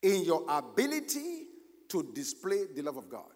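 preaching. Acted. Preaching at full force.

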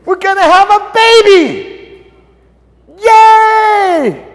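high, loud, slow cheer of joy; excited shout. Acted. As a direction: shout the news like a delighted parent. Joyful announcement of the pregnancy.

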